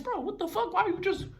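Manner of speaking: high outraged calls after him; outraged yelling. Retold or imitated. Kid chasing the thief down the street, high outraged yelling. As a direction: shout after the thief in disbelief. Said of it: "So I'm like".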